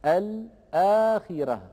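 الآخرة slowly, the hamza and long madd fully pronounced; slow demo of the original الآخرة; original form with hamza. Shown as a demonstration. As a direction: draw out الآخرة with a clear hamza. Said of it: "وأصل الكلمة الآخرة".

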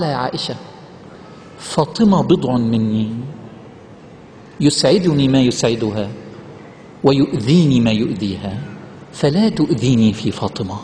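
low, slow and solemn recital; reverent hadith recitation. Acted. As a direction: recite the Prophet's words with reverence. Prophet's words recited in hadith. (تلاوة حديث بخشوع وصوت منخفض)

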